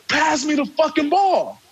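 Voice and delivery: a teammate's angry demand, pitched high; mock-angry shout to pass the ball; retold angry demand.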